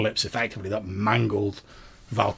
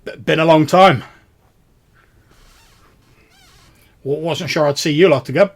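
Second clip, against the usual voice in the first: put-on loud, raised voice greeting old acquaintances; hearty ferryman; 6 semitones higher, 11 dB louder than usual. Ferryman meets returning travellers under the eaves, loud and raised. Game master plays the ferryman.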